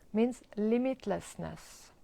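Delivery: normal talking voice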